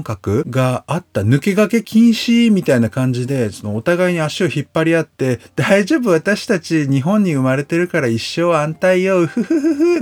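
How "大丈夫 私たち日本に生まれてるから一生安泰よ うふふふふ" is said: high, giggling, self-satisfied lady; smug complacent lady. Retold or imitated. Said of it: "なんつってやってられた". (得意げに笑うお嬢様声)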